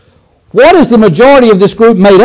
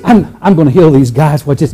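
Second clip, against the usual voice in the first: a chuckling, playful lower aside; laughing aside; 8 semitones lower, 3 dB quieter than usual. Acted. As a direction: grin and let the laugh through. Playful Jesus aside before healing.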